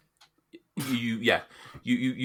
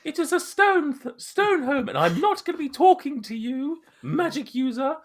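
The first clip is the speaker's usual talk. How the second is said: much higher haughty woman; lofty, high and prim; high-pitched NPC voice; 5 dB louder than usual. Acted. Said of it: "complete opposite end of the scale".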